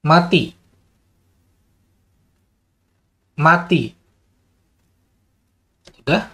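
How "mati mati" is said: Mati called out higher with a falling tune; repeating the command Mati to the module; steady command. Shown as a demonstration. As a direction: give the command the same way twice. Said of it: "intonasi harus benar-benar sama juga ya".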